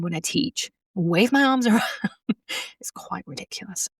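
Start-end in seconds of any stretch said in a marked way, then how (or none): 2.8-3.9 much softer whispered admission; whispered aside. Real, not acted. Owning up to listeners about waving arms, whispered. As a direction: drop to a whisper, a bit sheepish.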